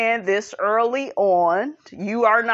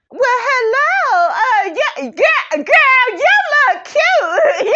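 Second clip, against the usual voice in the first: very high, loud, slow hammed-up squeal; Tisha impression; 7 dB louder, slower than usual. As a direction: greet her like an overexcited girlfriend, shrieking. Voices Tisha greeting Destiny.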